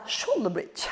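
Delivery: quiet Italian-accented English. Real, not acted. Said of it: "avec mon accent italien".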